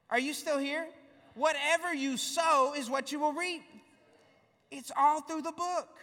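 rising preacher fervour; preaching. Real, not acted. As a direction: drive it home to the congregation. Preaching climax.

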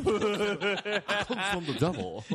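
a hint of the cowardly lion warning about the devil; cowardly lion impression. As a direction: do the cowardly lion, half-laughing. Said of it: "That's a cowardly lion saying that".

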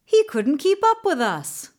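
loud, high protest; arguing. A narrator's reading. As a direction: argue back defensively. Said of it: "they argued".

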